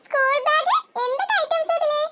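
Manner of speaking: put-on high, thrilled little girl's voice; excited child. Daughter to father over his gifts, thrilled and high. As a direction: squeal over the new school bag.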